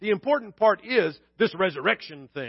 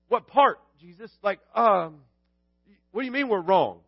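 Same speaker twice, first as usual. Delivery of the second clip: usual speaking voice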